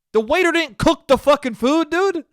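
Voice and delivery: high, loud, fed-up yell at customers; exasperated outburst. Real, not acted. Comic telling the crowd to lay off the waiter, exasperated yell. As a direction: throw the words up in disbelief. Ranting about rude customers.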